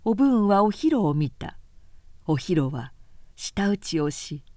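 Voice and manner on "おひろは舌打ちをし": ordinary delivery, nothing marked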